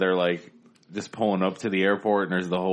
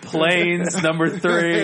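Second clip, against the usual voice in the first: loud joking; 9 semitones higher, 8 dB louder, much slower than usual. Real, not acted. Joking list, raised voice.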